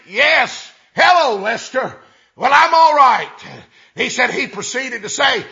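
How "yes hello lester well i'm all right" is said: louder, raised voice on the telephone; loud phone talk. Retold or imitated. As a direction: talk loud so the whole cafe hears. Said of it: "talking loud enough everybody in there could hear him".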